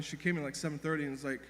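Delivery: normal talking voice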